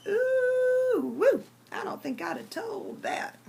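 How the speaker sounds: high, loud squall; squalled punchline. Acted. Preacher recoils at the farmer's confession, squalling high. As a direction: squall the last line out in shock. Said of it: "She just squalls that last line out".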